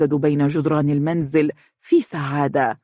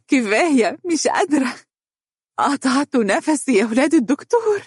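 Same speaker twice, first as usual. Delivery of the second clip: high, panting, laughing protest; winded from playing with the children; out of breath; 9 semitones higher than usual. A narrator's reading. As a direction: gasp it out between breaths. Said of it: "وتقول بأنفاس متقطعة".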